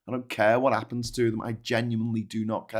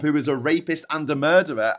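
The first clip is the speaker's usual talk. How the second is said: ordinary talk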